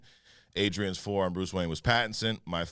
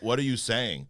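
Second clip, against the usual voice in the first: ordinary delivery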